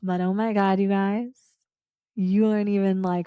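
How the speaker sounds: usual speaking voice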